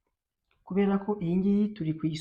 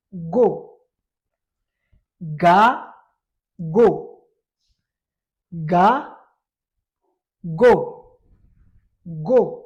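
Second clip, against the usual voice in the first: ga and go called out much louder; teacher leading a syllable drill; 10 dB louder, much slower than usual. Shown as a demonstration. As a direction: chant the syllables for the class to copy. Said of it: "teacher drilling syllables".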